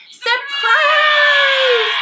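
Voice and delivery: high, loud crowd of little creatures yelling; shouted surprise. Acted. Little creatures shouting surprise at the boy. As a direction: burst out with a big happy yell. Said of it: "all the somethings shouted".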